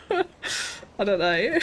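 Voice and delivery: laughing through the words, slow. Real, not acted. Unsure of her own plan, laughing through it. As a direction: giggle out the words.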